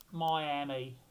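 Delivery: Miami in a mock Irish accent, first vowel rounded to "moy". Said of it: "I don't know why I say Miami with an Irish accent".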